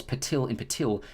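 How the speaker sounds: ordinary delivery, nothing marked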